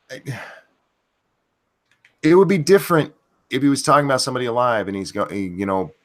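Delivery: usual speaking voice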